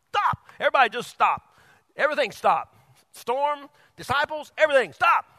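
high, loud order to stop; commanding shout. Acted. Jesus ordering storm and disciples to stop in a loud high shout. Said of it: "Jesus was like".

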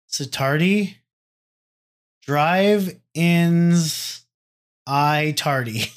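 "Sitardi?" tried as a question, then "Drive Ins I Tardy" in slow pieces; sounding it out. Real, not acted. Podcaster trying to parse a username, "I Tardy" sounded out haltingly. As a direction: test each chunk of "Drive Ins I Tardy" separately. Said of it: "I'm trying to parse this fucker's name".

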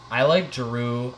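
ordinary talk, nothing marked

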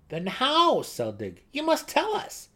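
high, loud demanding gnome; eager gnome. A narrator's reading. Young gnome begs Driz for the story, high and loud. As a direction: urge him on impatiently.

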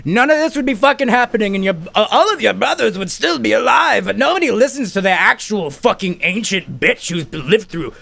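put-on high-pitched crotchety old woman; hammed-up ancient granny. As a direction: scold them in a high mock-granny voice. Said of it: "there's, like, this fucking granny bitch".

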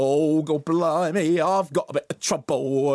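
hammed-up cockney sing-song; exaggerated London accent sliding into nonsense; mock London accent. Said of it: "London accent".

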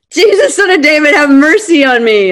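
a loud, high cry to be heard over the crowd; shouting for mercy. Retold or imitated. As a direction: call out desperately, high and loud. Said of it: "he shouts".

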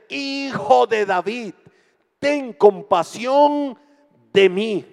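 slow, loud, high drawn-out plea. Acted. Bartimeo calling to Jesus over the crowd, a slow loud drawn-out cry. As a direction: stretch each word into a loud cry. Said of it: "Gritaba más fuerte".

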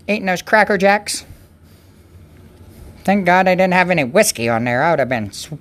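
normal talking voice

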